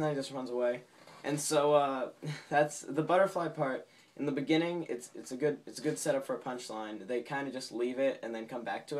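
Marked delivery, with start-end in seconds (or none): none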